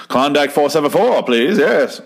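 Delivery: put-on old-time caller. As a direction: imitate asking an operator for a number. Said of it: "is it, like, literally a person".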